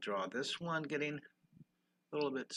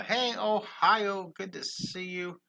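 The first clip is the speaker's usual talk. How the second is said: higher and slow, stretching the hello; drawn-out greeting; 7 semitones higher, 5 dB louder, slower than usual. Real, not acted. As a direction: call out a cheerful hello. Greeting a viewer from Ohio.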